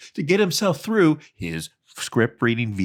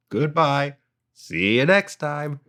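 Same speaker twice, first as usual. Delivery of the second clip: slow, rote YouTube sign-off; YouTube script reading tone; 4 semitones higher than usual. Shown as a demonstration. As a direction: sign off like a stock video script. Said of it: "I'm doing it a lot".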